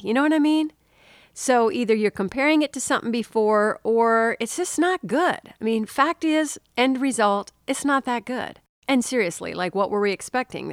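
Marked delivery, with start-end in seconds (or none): none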